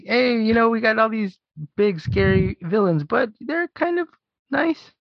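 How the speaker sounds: high-pitched imitation of sheepish filmmakers; mock filmmaker voice. Voicing filmmakers sparing their villains.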